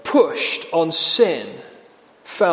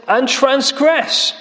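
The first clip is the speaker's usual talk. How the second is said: higher, slow, pointed jibe; sarcastic; 7 semitones higher, slower than usual. Retold or imitated. Amos twisting the call to worship, slow sarcasm. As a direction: drag out the punchline with scorn. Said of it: "So he's mocking".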